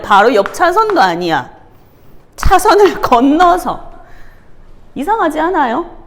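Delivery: normal talking voice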